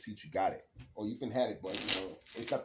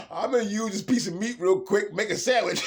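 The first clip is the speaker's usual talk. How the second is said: higher and louder, laughing through it; mock scheming man; 11 semitones higher, 11 dB louder than usual. Mocking a man who thinks he can fool women, hammed up and loud. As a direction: ham up a guy plotting a free meal. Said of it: "You cannot fool no women like".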